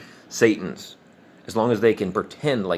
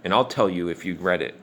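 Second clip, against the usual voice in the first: ordinary delivery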